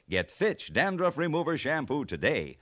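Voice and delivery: ordinary talk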